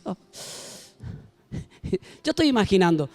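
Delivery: normal talking voice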